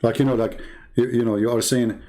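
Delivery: ordinary delivery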